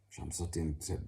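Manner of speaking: usual speaking voice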